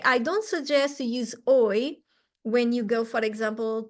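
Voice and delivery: ordinary talk, nothing marked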